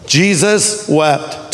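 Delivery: "Jesus wept" drawn out slowly; slow and grave on "Jesus wept"; solemn, weighted verse. Shown as a demonstration. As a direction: give "Jesus wept" two slow, separate words. Shortest verse, delivered for weight.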